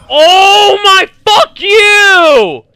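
very loud, high, drawn-out yell; full-volume furious gamer scream; real rage shout. Real, not acted. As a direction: explode at the enemy player. Raging at game opponent.

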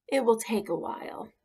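ordinary delivery

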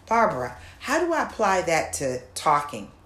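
ordinary talk

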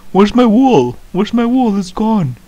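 frantic, searching for lost wool; panicked. Real, not acted. Player loses her wool.

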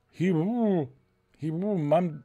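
talking through a mouthful; words garbled round a bite of bread; mouth full. Acted. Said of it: "started talking with her mouth full".

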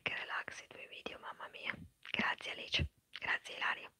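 ordinary talk, nothing marked

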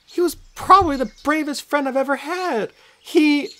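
put-on high, tearful girl's voice; a young girl holding back tears; tearful Annabeth. A narrator's reading. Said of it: "Her eyes were puffy from crying".

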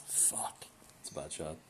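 frustrated whispered curse; whispering. Real, not acted. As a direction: hiss it under your breath. Frustrated at a bad shot.

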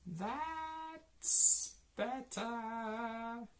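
very high, slowly stretched character voice; Missus Wishy-Washy. A narrator's reading. Satisfied washerwoman approves the clean animals, high drawn-out voice. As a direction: hold each word long and high. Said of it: "says Missus Wishy-Washy".

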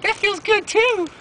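very high, slow falsetto; drawn-out squeaky girlfriend voice; girlfriend's voice. Retold or imitated. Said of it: "She was like".

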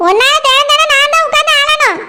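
ordinary talk

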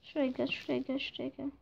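very soft repeated murmur; barely voiced, careful urging; hushed coaxing. Real, not acted. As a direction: coax it over under your breath. Coaxing himself through after calming.

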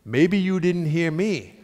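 slow, deliberate comeback; measured challenge ending on a pointed "pal"; rude retort. Retold or imitated. He answers the monk back, slow and rude. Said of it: "that would just be rude".

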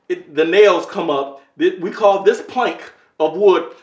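usual speaking voice

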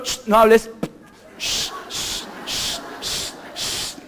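sharp repeated shushes; hushing. Acted. Preacher silencing the brother who comes to him, hissed shushing. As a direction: shush someone over and over in short hisses.

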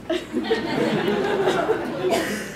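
can't stop giggling; laughing. Real, not acted. Laughing at hop line.

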